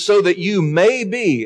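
"may" pushed up high within the quote; stress on may. Shown as a demonstration. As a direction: lift and lean on the word "may". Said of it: "notice it's a may".

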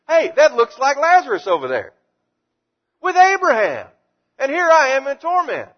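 high, loud, anguished rich man; rich man in torment. Acted. Rich man seeing Lazarus in Hades.

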